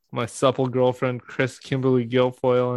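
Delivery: ordinary talk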